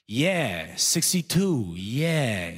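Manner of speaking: drawn-out, clueless ad-libbed echo; senile older guy. Acted. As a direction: chime in like you have no idea what's happening. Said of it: "Then the second older guy goes".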